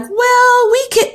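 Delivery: high, squeaky mock protest; mock protesting consultants. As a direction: squeak it out like flustered nurses cut off mid-sentence. Mimicking lactation consultants protesting.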